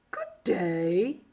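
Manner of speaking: low bleating goat voice. A narrator's reading. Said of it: "bleated the goat".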